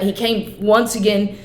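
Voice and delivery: normal talking voice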